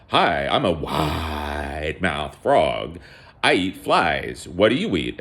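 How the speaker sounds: slow, stretched-out frog greeting; cartoon frog introducing itself, slowly; wide-mouthed frog. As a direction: draw out the WIDE like a storybook frog. Voices the frog in tale.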